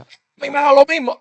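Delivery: high, shrugging "me da lo mismo"; indifferent person. Retold or imitated. Preacher mimics careless people in the church, shrugging and high. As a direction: toss it off like you don't care. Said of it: "Hay personas que piensan así".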